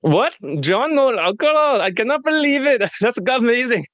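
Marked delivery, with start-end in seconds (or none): 0.0-2.8 hammed-up Indian accent, can't believe it; over-the-top Indian accent, shocked; mock Indian accent. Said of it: "is it okay if I do an accent?".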